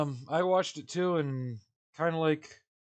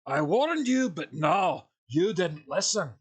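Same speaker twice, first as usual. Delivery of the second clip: loud, higher bad Scottish accent; booming warning in a poor Scottish accent; Tim the Enchanter; 5 semitones higher, 7 dB louder, slower than usual. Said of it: "to quote Tim the Enchanter".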